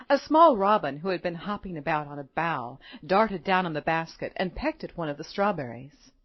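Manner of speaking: usual speaking voice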